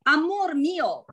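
amor mio slow, pitched high for emphasis; 强调mio. Shown as a demonstration. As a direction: draw out "amor mio" to stress possession. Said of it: "就是强调是我的亲爱的". (强调“amor mio”，高而慢地说)

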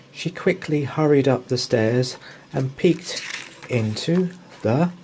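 usual speaking voice

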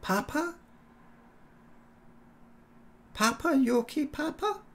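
put-on high, soft worried child voice; a small girl asking for her dad; little girl calling. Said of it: "she went".

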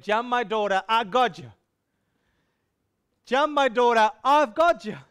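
put-on loud, high, urging shout to a child; big father calling his daughter to leap; fatherly call.

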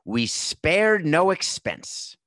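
grand, slow, high showman's boast; film park founder's proclamation. Acted. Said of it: "he would point things out and say".

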